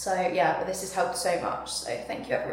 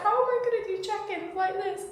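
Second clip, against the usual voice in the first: mock tearful whine; 11 semitones higher than usual. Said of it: "I was like".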